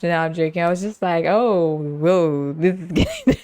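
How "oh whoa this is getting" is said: acted giggly protest, pitch climbing; laughing to avoid crying. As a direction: laugh through the words, backing away from emotion. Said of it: "I laughed".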